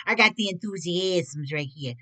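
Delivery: lightly squeaky rodent voice; squeaky rat voice. Pretend. Card reader toys with the word in a mock rat voice. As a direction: play with the word like a little rat. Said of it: "almost sounds like a rat".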